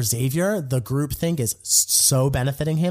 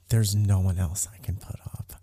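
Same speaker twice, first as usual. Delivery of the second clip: whisper; 5 semitones lower, 4 dB quieter than usual. Retold or imitated. Tiffany quietly breaks news to Claire.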